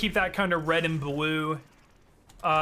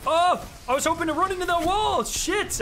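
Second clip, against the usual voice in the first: high, quick exclamation of dismay; exasperated high-pitched gamer yelp; frustrated outburst; 12 semitones higher, 4 dB louder than usual. Real, not acted. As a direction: cry out high and fast at a botched move. Upset outburst at a failed move.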